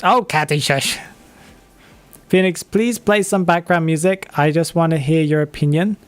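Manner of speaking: ordinary talk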